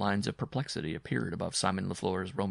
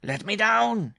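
loud, very high dwarf shout; crying out angrily; 13 semitones higher, 8 dB louder, slower than usual. A narrator's reading. Dwarf held aloft by his bride, angry high shout. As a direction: demand it in a high-pitched yell. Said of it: "he cried again".

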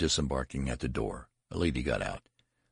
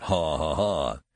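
spoken mock laughter; laughed; 3 dB louder, slower than usual. A narrator's reading. Undergraduates laughing at Benjamin, mildly voiced mock laugh. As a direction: read the laugh as distinct words. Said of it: "the undergraduates laughed".

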